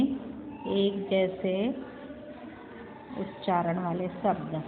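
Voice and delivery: very slow, soft dictation pace, words drawn out. Shown as a demonstration. Teacher dictating a heading for pupils to copy, slow and soft. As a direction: spell it out slowly so the children can write. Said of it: "इसको हम क्या लिखेंगे हिंदी में?".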